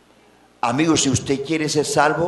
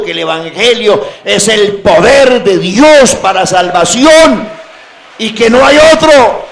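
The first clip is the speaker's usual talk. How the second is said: full-force preaching; 6 semitones higher, 11 dB louder than usual. Real, not acted. Preacher testifying that the gospel is salvation, full-force preaching. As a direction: build to a high, ringing shout.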